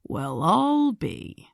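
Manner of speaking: slow, dry and unimpressed; deadpan, drawn out. Acted. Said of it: "he said dryly".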